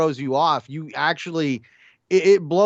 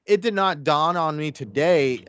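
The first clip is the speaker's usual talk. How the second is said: ordinary talk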